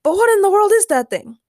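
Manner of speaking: high, loud astonished question; high-pitched, incredulous outburst; alarmed bewilderment. A narrator's reading. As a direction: exclaim it as a baffled king. King alarmed at the strange object.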